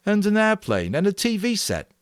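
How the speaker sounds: sarcastic boy voice, high and mocking; sour sarcasm. A narrator's reading. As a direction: sneer the list at him. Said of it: "Ralph said sourly".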